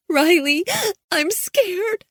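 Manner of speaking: put-on high, tearful girl's plea; a frightened girl close to tears; crying, scared. A narrator's reading. Girl on the phone to Riley, scared and crying. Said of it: "Hannah began to cry".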